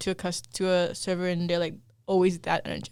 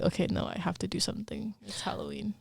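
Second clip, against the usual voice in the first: whispered; 10 dB quieter than usual. Retold or imitated. Inner voice.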